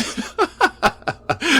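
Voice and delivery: high manic cackle of a madman; insane laughter. Acted. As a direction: burst into a mad high cackle. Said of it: "the sound of his insane glee".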